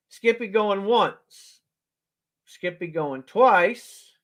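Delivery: slow drawn-out going once, going twice; slow auctioneer call. Real, not acted. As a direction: stretch each word for suspense.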